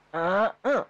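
"eh, eh" jumped high and loud; high-pitched Betawi "eh, eh". Shown as a demonstration. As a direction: show how high the Betawi tune goes. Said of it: "kalau dibuat nada tuh kayak".

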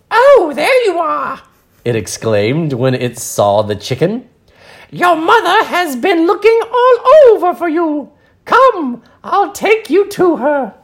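high, loud British bird voice; a fussy British bird, pitched high; red and blue bird greeting the chicken in British; British accent. Acted. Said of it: "she's British, apparently".

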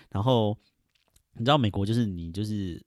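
usual speaking voice

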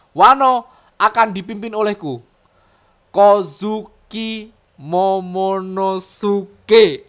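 loud, high proclamation, name drawn out slowly; Momonosuke. Acted. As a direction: declaim it like a new ruler. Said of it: "kata-kata dari si Momo ini". (seruan pemimpin yang lantang, nama diucapkan lambat)

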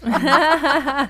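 high laugh; amused burst; real laugh. Real, not acted. Host laughs at joke. (开怀大笑)

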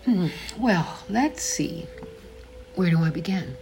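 ordinary delivery, nothing marked

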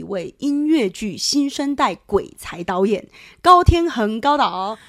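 bright radio host welcome; public radio host. Acted. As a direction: open the show like a DJ. Host opens the radio show.

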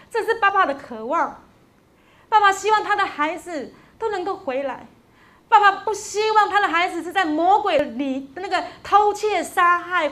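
impassioned preacher pressing each point; preaching. Acted. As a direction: drive it home to the congregation. Preaching at full force.

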